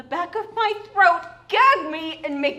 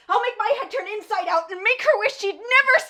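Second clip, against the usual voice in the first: higher, louder ghostly threat; louder, higher jealous ghost threat; vengeful threat; 8 semitones higher, 7 dB louder than usual. Acted. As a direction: spit out a haunting vow. Ghost threatens to haunt rival.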